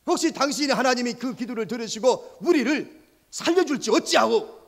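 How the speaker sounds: high, loud exasperated scolding; scolding captain. Acted. Captain scolds sleeping Jonah, loud and exasperated. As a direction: shake the sleeper awake, annoyed.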